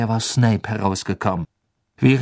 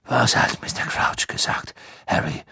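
whispery, intent questioning; hushed Dumbledore. Acted. Dumbledore questioning Harry on the stairs, hushed and intent. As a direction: ask in a hushed old headmaster voice.

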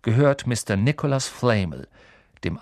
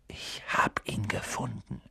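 breathless stunned whisper; 9 dB quieter than usual. A narrator's reading. Harry tells Ron and Hermine of his find, in a whisper. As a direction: whisper it, barely daring to believe. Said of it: "flüsterte er".

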